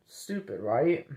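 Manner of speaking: ordinary talk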